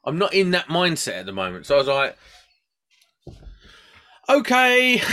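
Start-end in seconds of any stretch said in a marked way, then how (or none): 4.3-5.0 a high, loud, strangled okay; stunned mock okay. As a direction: swallow your pride, squeak it out loud. Retold stunned reply to coworker.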